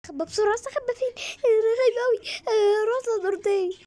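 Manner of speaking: frightened, high and crying; scared. Acted. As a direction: panic as you hide from mom. Child hiding scared from mom. (خايفة وبتعيط بصوت عالي)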